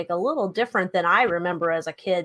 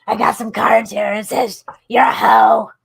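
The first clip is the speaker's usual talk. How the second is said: psychic voice; 9 dB louder than usual. Pretend. Psychic Joni reading cards.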